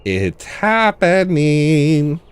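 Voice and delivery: very slow, dragged-out, strange voice; imitating Lawrence. Lawrence turns to the other kids, slow and drawn out. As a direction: draw every syllable out very slowly. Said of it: "he goes".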